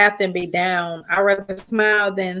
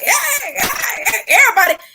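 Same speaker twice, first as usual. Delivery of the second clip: high shouted family welcome, way up; family calling out; 11 semitones higher than usual. Retold or imitated. Family reunion guests hollering hello. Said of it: "boy, you gonna be like".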